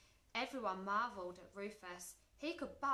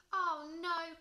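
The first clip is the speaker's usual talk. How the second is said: high, loud "oh no"; dismayed cry; 7 semitones higher, 7 dB louder than usual. A narrator's reading. George seeing the broken chair, crying out in dismay. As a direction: cry out in alarm. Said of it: "cried George".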